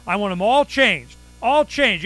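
high, loud, indignant demanding; mock outraged demand. Owner demanding the city change every valve, hammed-up outrage. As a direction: ham up the outraged customer. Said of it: "I'm going".

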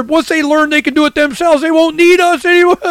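high, put-on voice of a scheming lobbyist; mocking insider voice. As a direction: sneer it like the special interests talking among themselves. Mocking the special interest groups.

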